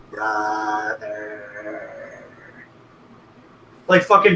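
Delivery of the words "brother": low, soft eerie whisper of brother. Retold or imitated. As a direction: breathe the word like a creepy sibling. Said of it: "they just whisper".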